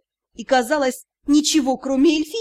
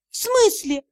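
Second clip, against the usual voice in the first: puzzled; 8 semitones higher than usual. A narrator's reading. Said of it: "не поняла я".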